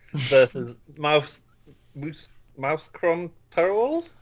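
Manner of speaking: slow, halting attempts at a foreign club name; stumbling over names. Real, not acted. Podcast guest struggling to read out Belgian fixtures, halting and unsure. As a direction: falter and restart, ending on a questioning rise. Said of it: "Have you got Tourette's or something".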